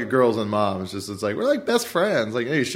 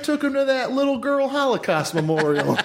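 much higher, smug put-on dad; mock proud dad; 11 semitones higher than usual. Mocking the dad's idea of culture, in a high smug voice. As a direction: boast like a clueless father.

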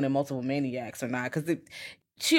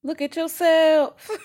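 teasing high drawl; 12 semitones higher, 5 dB louder, slower than usual. Acted. Teasing co-host.

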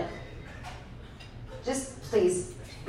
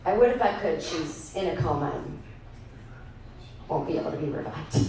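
imitated despondency, trailing down; subdued, grieving; 6 semitones lower, 5 dB quieter than usual. Customer telling clerk of friend's coma, subdued. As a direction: sink into a low, quiet voice.

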